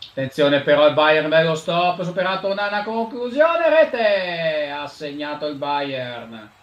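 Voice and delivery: rising excited goal call; breathless italian goal commentary. Acted. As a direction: build up to the goal.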